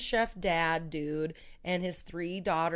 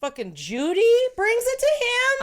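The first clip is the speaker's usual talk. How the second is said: much higher and louder, gushing; excited burst; 11 dB louder than usual. Real, not acted. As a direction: blurt it out, thrilled. Gushing about favourite scene.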